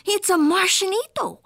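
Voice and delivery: put-on high, amazed kitty-boy cry; startled exclamation. A narrator's reading. Skipito spotting a Martian, startled. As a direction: cry out the discovery. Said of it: "gulped Skipito".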